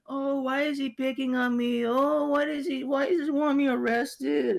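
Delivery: high, whiny fake sobbing plea, slowing at the end; woman fake-crying to police, mocked in a high whine. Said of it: "she started crying in, like, now fluent English".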